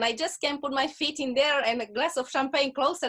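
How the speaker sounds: normal talking voice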